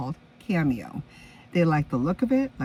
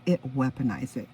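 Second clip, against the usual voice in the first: ordinary talk, nothing marked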